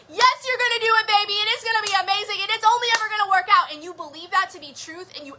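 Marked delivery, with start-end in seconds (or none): 0.1-3.7 higher, fast gushing pageant mom. Retold or imitated. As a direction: gush like a pushy pageant mom cheering her kid. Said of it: "that inner, like, pageant mom was like".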